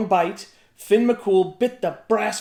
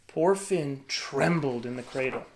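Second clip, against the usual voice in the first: ordinary delivery, nothing marked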